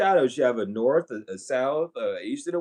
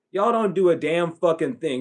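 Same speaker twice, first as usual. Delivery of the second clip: usual speaking voice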